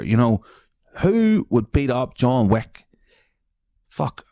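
ordinary talk, nothing marked

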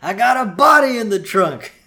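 high, loud mobster outburst; mock yelling. Mobster stuck in bridge traffic, impatient mock yell. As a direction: holler it impatiently, stretching the words. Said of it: "He's just yelling".

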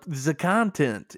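THE said as "za" in a bad accent; terrible muddled foreign accent. Co-host slips on the word content, terrible unplaceable accent. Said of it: "what kind of accent that was".

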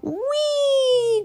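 very high, long whee; gleeful cry. A narrator's reading. Bob racing on horseback, high gleeful squeal. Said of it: "cried Bob".

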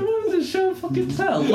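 very high mock-incredulous squeal; high-pitched question through laughter; laughing outburst. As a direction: crack up on the punchline. Acting own outburst, laughing.

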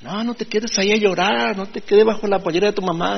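lightly mocking a peppy influencer; imitating an influencer. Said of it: "le dice".

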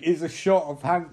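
ordinary talk

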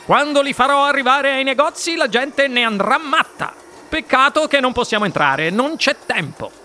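high-pitched excited boast, easing at the end; Wonka di corsa. A narrator's reading. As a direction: enthuse up high, then brush it off. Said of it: "commentò di corsa il signor Wonka". (entusiasmo acuto di chi va di corsa)

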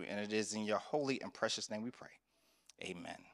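earnest prayer voice, softening at the end; pastor's closing prayer, fading to a hush. Acted. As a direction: pray over the congregation. Leads congregation in prayer.

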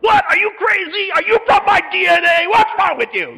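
high, loud hammed-up disbelief; mock outraged shout. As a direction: shout it like a shocked father. Dad outraged at son.

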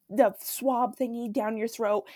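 usual speaking voice

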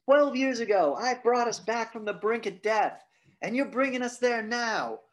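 booming mock lecture to kids. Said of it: "And he's like".

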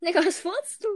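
high excited shouting; rowdy gamer yell; loud yelling. Real, not acted. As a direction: shout it over the game. Yelling during game.

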